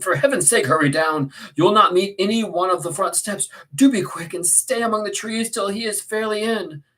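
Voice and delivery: high, loud urging; alarmed exclamation. Acted. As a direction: urge him to hurry and hide. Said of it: "I exclaimed".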